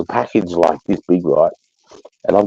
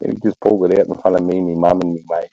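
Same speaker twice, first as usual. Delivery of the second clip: normal talking voice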